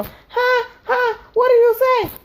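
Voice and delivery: high, loud puzzled questioning; high comic foreign voice; confused foreigner. Pretend. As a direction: squawk like a baffled stranger. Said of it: "foreign channels".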